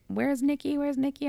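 high, confused calling for a friend; delirious calling. Retold or imitated. To her mom after surgery, delirious high calling. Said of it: "I'm, like, delirious".

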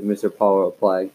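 ordinary delivery

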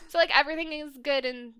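ordinary talk, nothing marked

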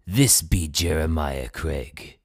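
slow, hearty pirate growl. Pretend. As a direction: talk like an old sea captain. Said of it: "Talk Like a Pirate Day".

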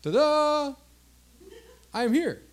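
high, showy little arrival; mock ta-da announcement. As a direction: sing out a playful grand entrance. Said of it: "He's not just saying".

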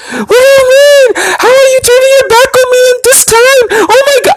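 very high mock wailing; hysterical crying impression. Girl reacting to the breakup, mock hysterical sobbing. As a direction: wail like a hysterical woman, hammed-up. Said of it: "She's hysterical".

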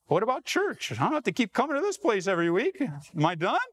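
high, quick, rising questions; incredulous questioning. Acted. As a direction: play a churchgoer testing an unbelievable offer. Acts out incredulous churchgoer's questions.

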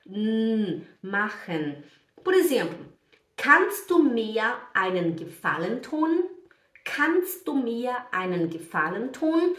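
ordinary talk, nothing marked

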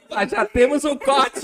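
rindo alto no meio da fala; laughing. Real, not acted. Piada sobre o sobrenome. (rindo alto)

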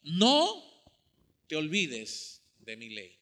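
solemn divine admonition; voice of God. Acted. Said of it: "Y Dios dice".

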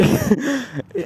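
short, high giggle; helpless little chuckle; real laugh. Real, not acted. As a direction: break up before the quote. Cracks up mid-quote.

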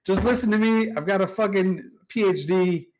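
hammed-up bossy yell at Elise; insistent shout. Gobbins insisting, voiced in story.

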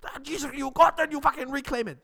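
put-on furious high German-accented halftime speech; German accent halftime rant. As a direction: lace into the team in a German accent. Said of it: "just in a German accent".

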